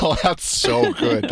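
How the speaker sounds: put-on hammy Boston accent, drawn out. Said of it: "as the actual Boston accent".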